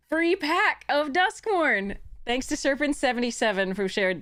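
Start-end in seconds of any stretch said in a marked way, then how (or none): 0.1-2.0 slow, high, stretched words; drawn-out excitement. Real, not acted. Streamer tells the winner their prize, slow and high. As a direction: savour the prize.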